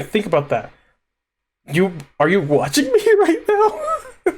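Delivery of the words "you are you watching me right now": way higher, slow and laughing through it; marvel, almost squealing with delight; giddy disbelief. Real, not acted. Realising someone is watching him right now, giddy high disbelief.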